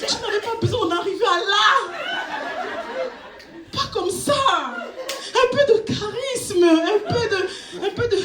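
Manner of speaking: high, slowed pleading scold with laughter; comic exasperated reproach; indignant reproach. Retold or imitated. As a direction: lecture him, exasperated. Scolding the date.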